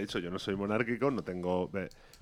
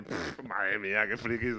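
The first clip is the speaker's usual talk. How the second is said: scoffing; 5 semitones lower, 7 dB quieter, slower than usual. Retold or imitated. Mocking the excited crowd.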